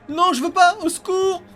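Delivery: high, loud frightened cries; panicked screaming. Real, not acted. Caught by game monster.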